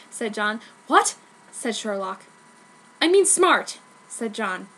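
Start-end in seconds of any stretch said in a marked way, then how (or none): 0.9-1.2 high startled what; surprised. Acted. Sherlock startled. 3.0-3.8 high, loud quick correction; flustered correction. Acted. John correcting himself quickly.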